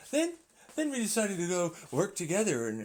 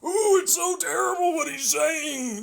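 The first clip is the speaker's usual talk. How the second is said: high, slow, loud prissy gasp; mock offended critic; 10 semitones higher, 4 dB louder than usual. Scoffing critic clutching pearls at the speaker, high and slow. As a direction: ham up the shocked critic.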